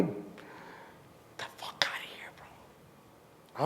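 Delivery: slow hushed brush-off; dismissive whisper. Real, not acted. Rapper whispering a dismissal of fame-chasing. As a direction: wave it off under your breath.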